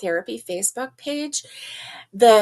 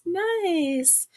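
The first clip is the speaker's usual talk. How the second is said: a lifted, sing-song second Nice; playful higher repeat of Nice; higher echo; 6 semitones higher than usual. Shown as a demonstration.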